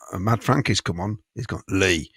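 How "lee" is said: lightly voiced London hard man; slightly lower, with a hint of London gangster; mock London hard-man talk; Mad Frankie. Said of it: "meant to be a London accent".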